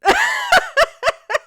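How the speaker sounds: high, loud shriek of laughter; a loud cackle at her own joke. Real, not acted.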